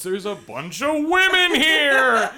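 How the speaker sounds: slow, high, loud triumphant yell; gloating shout. Retold or imitated. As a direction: crow it out over the nerds. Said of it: "It's like".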